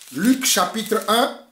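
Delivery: usual speaking voice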